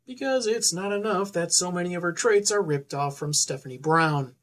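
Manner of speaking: normal talking voice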